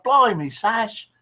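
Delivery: slow drawn-out Essex "Blimey, Sash"; a light Essex accent, stretched slowly. Retold or imitated. Host reading a viewer's comment in a mild Essex accent. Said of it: "she's from Essex and they speak like that".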